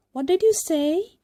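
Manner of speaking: can't believe what he heard; astonished. A narrator's reading. Puppet master stunned by Pinocchio, loud disbelief.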